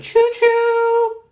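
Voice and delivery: loud, high choo-choo; train whistle imitation. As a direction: toot like a toy train.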